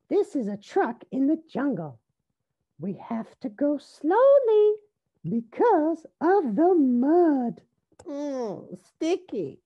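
high, dramatic character voice; little rabbit Rosie. A narrator's reading.

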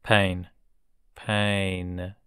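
usual speaking voice

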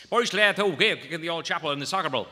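fast, loud mumbled Irish brogue; thick Irish priest. Retold or imitated. Comedian imitating an old Irish priest in a thick accent. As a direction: rattle it off in an unintelligible Irish accent. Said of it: "it sounded like he said this".

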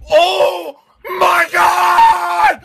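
usual speaking voice

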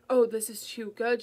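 loud hooting exclamation; loud forced hoot of glee. A narrator's reading. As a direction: hoot it out in mock delight. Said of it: "she hooted".

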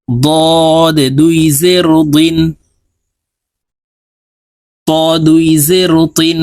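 slow sing-song letter drill, each line rising; tajweed recitation drill. Shown as a demonstration. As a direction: chant each letter for students to repeat. (ধীর সুরেলা তাজবিদ উচ্চারণ অনুশীলন)

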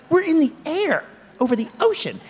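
high, incredulous panic; mock-horrified, voice jumping up high; retold alarm. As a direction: protest in exaggerated alarm. Retelling alarm at people by exit door.

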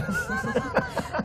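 high airy chuckle; breathy laugh. Real, not acted. Guest chuckling at the junta chief, airy laugh. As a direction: laugh off the jab.

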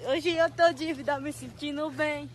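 loud repeat. Real, not acted. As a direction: say "dívida" loudly instead of diva. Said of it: "Como é que ela sabe falar dívida".

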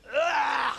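loud grossed-out ugh; disgusted groan at stale food; mock disgust. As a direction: gag at the taste. Imagined reaction to old Twinkie.

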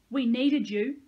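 hurt, forceful accusation; accusing. A narrator's reading. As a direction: throw the blame at the listener. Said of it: "Sahalia said accusingly".

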